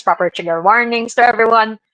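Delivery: ordinary talk, nothing marked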